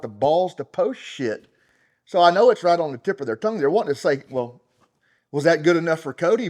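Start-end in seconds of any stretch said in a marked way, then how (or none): 2.0-6.5 fast, loud, higher worked-up rant; heated and hurried, voice climbing; fired up. Real, not acted. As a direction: let it rush out, riled up. Worked-up rant about naysayers.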